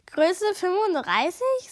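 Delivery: slow skeptical query. Acted. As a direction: drag it out in disbelief. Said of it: "sagt Lasse skeptisch".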